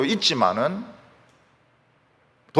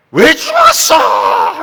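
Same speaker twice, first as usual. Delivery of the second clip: long, high shout at the dead; re-enacting a drawn-out anguished scream; grieving yell; 10 dB louder, much slower than usual. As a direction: howl the question at full voice. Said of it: "하면서 막 소리".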